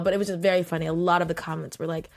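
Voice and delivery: normal talking voice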